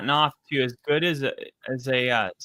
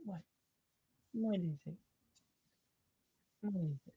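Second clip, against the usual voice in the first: soft dog talk; 3 semitones higher, 16 dB quieter, faster than usual. Real, not acted. Talking to the dog.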